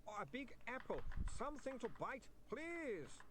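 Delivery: high, slow pleading voice of a shaky vampire; a frantic vampire clerk begging for cheese; desperate vampire. A narrator's reading. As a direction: beg for food, slow and high.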